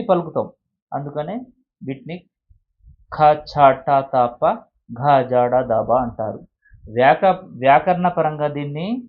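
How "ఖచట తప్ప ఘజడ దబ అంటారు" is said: ఖచట తప్ప, ఘజడ దబ with strong breathy aspiration, very slowly; aspirated letters. Shown as a demonstration. Teacher repeating the heavy letters for class, slowly aspirated. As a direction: press ఖ and ఘ out with air, one by one. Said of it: "ఒత్తి పలికే అక్షరాలు".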